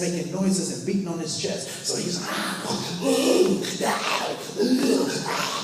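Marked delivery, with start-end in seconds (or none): 2.2-5.6 high, loud ape hooting calls; gorilla hoots. Retold or imitated. Silverback hooting to scare off the young rival, loud ape calls. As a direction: whoop like a chest-beating silverback.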